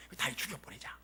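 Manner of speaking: soft, hushed plotting whisper; conspiring whisper. Acted. Soldiers gathered behind, plotting to kill David in a whisper. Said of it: "뒤에 모여가지고".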